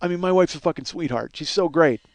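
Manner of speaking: ordinary talk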